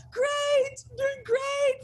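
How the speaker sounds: brightly mask the panic inside; fake cheerful. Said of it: "And I was, like, smiling".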